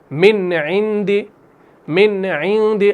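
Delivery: मिन इंदी slowly with a clear, distinct न before इंदी; clear noon. Shown as a demonstration. Tajweed teacher demonstrating izhar example to students, slow and clear. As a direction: pronounce the nun plainly and clearly, drawn out. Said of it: "मिसाल के तौर पर हम कहेंगे".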